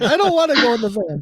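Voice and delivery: high, loud mock pleading; sleep talking. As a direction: cry out in a put-on high voice. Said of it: "I'm just, like, talking".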